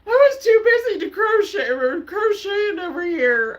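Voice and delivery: high, loud words squeezed out through laughter; laughing till crying. Real, not acted. Watching a funny clip, laughing so hard she's crying. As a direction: talk while laughing to tears. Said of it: "I'm crying".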